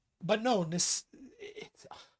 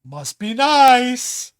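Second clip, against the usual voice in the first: very slow, louder, drawn-out snide jab; snide remark; 5 semitones higher, 11 dB louder, much slower than usual. Retold or imitated. Someone envies another's success, slow drawn-out sarcasm. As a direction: stretch it out with sarcastic envy. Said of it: "I'm hearing this a lot".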